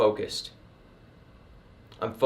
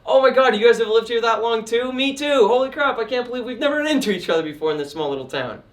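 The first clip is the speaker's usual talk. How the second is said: much higher and louder, gushing excitement; fun expressive customer; 10 semitones higher, 5 dB louder than usual. Shown as a demonstration. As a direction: gush like a high-energy chatty neighbor. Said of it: "fun and expressive".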